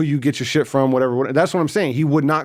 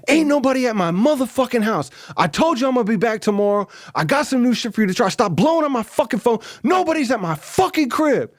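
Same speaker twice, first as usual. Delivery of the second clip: higher-pitched imitated rant down the phone; mock angry snap; 8 semitones higher than usual. Dealer snapping at the caller on the phone, imitated higher-pitched angry rant. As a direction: snap back like a dealer sick of calls. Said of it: "he snaps on the phone".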